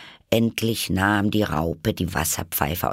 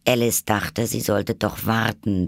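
normal talking voice

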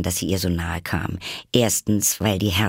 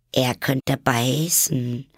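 slow, higher-pitched careful warning; cautious; 6 semitones higher, much slower than usual. A narrator's reading. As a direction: stretch it out warily, as a gentle warning. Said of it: "erwiderte Alice vorsichtig".

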